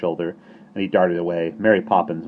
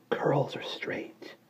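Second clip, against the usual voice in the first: slow, soft airy whisper; 8 semitones higher, 7 dB quieter, much slower than usual. Acted. Breeze whispering to the baby, slow and soft. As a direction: breathe the words like a breeze. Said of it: "it whispered".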